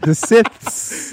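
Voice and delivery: "The Siths" said high and loud, stumbling on the th; "The Siths" with a fumbled lisped ending; mispronounced. Real, not acted. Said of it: "That's a hard word to say".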